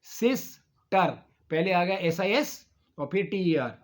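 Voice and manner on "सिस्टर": सिस्टर slowly, with a break after सिस; split into syllables. Shown as a demonstration. Teacher showing a two-syllable word, सिस्टर broken slowly in two. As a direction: say सिस्टर as two separate beats. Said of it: "दो syllable हो गए".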